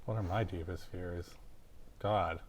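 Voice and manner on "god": normal talking voice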